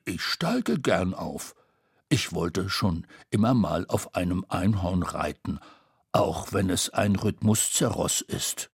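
eager to ride. A narrator's reading. Tete voice held. (Tetes tiefere Stimme)

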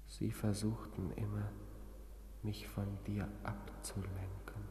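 slow, soft whisper. Acted. Patient confiding to his girlfriend, whispering slowly. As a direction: let it fade to a whisper.

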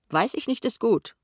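high, rising jeer; needling mimicry; mocking echo. A narrator's reading. Kessler throws the answer back mockingly.

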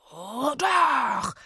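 high, drawn-out spooky wheeze; eerie gasping reply; wheezing ghost. A narrator's reading. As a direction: wheeze it like a menacing spirit. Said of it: "keuchte Itzig".

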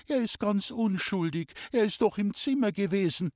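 high, earnest pleading voice; mother defending son. A narrator's reading. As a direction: insist on the boy's innocence. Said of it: "hat meine Mutter gesagt". (hohe Mutterstimme, eindringlich verteidigend)

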